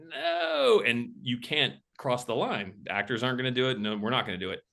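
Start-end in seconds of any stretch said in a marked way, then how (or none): 0.1-0.8 high, drawn-out cry of protest; hammed-up anguish; dismayed wail. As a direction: wail it high, like plans just collapsed. Retold dismay at halted shoot.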